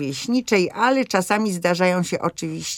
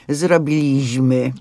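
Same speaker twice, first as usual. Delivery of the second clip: Zrobiliśmy slowly and louder, stress wrongly on BI; mock the bad form zroBIliśmy, hitting BI; wrong penultimate stress; 3 semitones lower, 5 dB louder, slower than usual. Shown as a demonstration. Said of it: "nie ma nic gorszego niż właśnie to powiedzenie".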